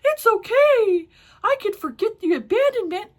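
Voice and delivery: very high, loud, singsong mock forgiveness; hammed-up, high la-di-da voice; mocking forgiving son. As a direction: sarcastically chirp it at full volume. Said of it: "you just want him to be like".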